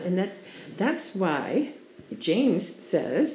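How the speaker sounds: ordinary delivery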